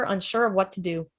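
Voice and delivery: ordinary delivery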